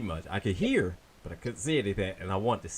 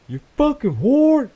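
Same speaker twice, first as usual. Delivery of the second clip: very loud, high-pitched drawn-out yell; yelled insult; 11 dB louder, slower than usual. Retold or imitated. Neighbor's yell that grabs a listener's attention, imitated holler. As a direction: imitate a neighbor bellowing it, stretched out. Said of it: "And he yells out".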